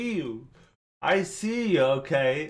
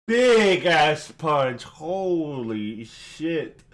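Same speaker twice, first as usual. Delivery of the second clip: amazed; 4 dB louder, much slower than usual. Real, not acted. Reacting to a hard-hitting line.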